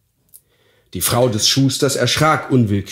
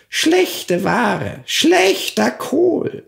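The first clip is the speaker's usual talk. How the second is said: very high, slowly drawn witch sneer; scornful hag; much slower than usual. Acted. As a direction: drag out the insult like a sour crone. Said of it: "sprach auch hier".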